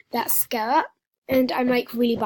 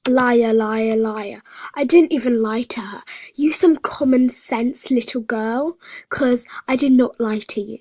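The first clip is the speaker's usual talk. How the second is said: loud, scolding voice; angry accusation; 7 dB louder than usual. Real, not acted. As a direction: tell her off loudly. Arguing with a scammer.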